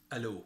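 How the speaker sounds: hello as "alo", no "h"; Egyptian pronunciation. Shown as a demonstration. Teacher repeats the phone greeting, Egyptian pronunciation. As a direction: say hello starting on the vowel, "a-lo". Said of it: "make different pronunciation out of it".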